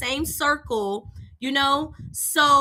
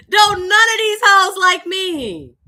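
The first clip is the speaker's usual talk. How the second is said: high, loud, slow emphatic punchline; 7 semitones higher, 10 dB louder, slower than usual. Real, not acted. Storyteller repeating her joke to listeners, loud and high. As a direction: belt the line out to the audience.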